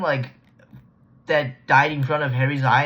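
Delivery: ordinary delivery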